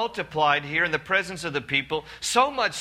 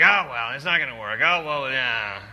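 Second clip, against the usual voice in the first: a hint of mopey Eeyore, lower; lightly voiced gloomy sigh of defeat; 5 semitones lower than usual. [name] sounds like a defeated Eeyore, mildly mopey and low.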